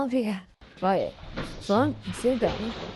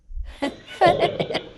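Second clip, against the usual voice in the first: short breathy giggle, pitched up; high nervous laugh; 8 semitones higher than usual. Real, not acted. Investigator laughing nervously at his readiness, high giggle. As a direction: let out a quick nervous laugh.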